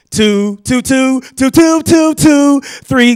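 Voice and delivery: high, loud chant, climbing as it goes; child reciting keys. Acted. Child reciting flip-phone key presses.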